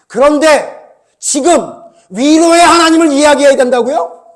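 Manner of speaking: rising from measured to loud preaching; preacher's voice swelling into a loud challenge; building sermon emphasis. Acted. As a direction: build it up like a preacher driving home a warning. Preacher presses the point.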